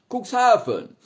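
high, loud shout. Acted. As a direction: yell the city name. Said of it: "warum ich so schreie immer".

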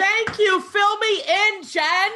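high, loud and thrilled, begging for the gossip; excited squeal of gratitude; eager excitement. Real, not acted. Gossip reveal, eager to hear.

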